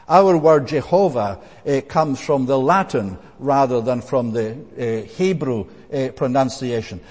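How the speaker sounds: ordinary talk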